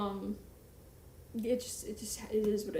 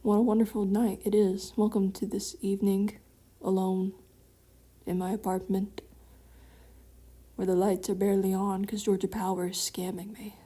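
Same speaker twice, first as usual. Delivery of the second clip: mock grand host welcome; 4 dB louder than usual. Greets raid in mock host voice.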